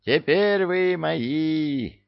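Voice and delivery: high, very slow howl. Acted. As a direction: howl it in triumph. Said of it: "взвыл некромант".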